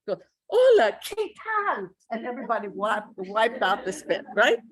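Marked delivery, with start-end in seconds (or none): 0.5-1.9 put-on Texan rancher accent, high and loud, spitting on the t; Texan rancher Spanish. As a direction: blast "¿qué tal?" with a heavy Texan aspirated t. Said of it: "speaking like a Texan rancher".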